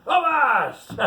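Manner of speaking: high, loud pirate cry of Avast; shouted avast. A narrator's reading. Pirate reading the story, shouting the captain's cry. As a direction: bellow it like a sea captain.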